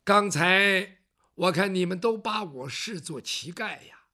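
ordinary delivery, nothing marked

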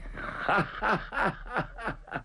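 a high, airy chuckle; soft amused chuckle; laughing. Acted. Masked man laughing at Zhang Fang.

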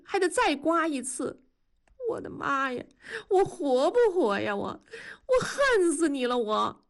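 high-pitched, aggrieved whining tirade; distressed railing. A narrator's reading. Wife dreads the operation and blames husband, distressed. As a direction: wail and blame him in a high voice.

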